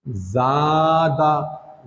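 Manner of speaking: ज़ादा stretched very slowly, long aa; drawn-out ज़ादा. Shown as a demonstration. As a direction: pull out the vowels of ज़ादा. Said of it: "ये खिंचाएगा". (ज़ादा को बहुत खींचकर)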